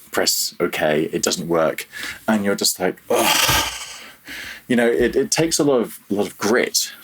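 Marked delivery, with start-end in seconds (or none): 3.1-4.0 breathy exasperated "ugh"; mock groan of frustration; frustrated groan. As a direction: act out a fed-up sigh. Imitates frustration when build fails.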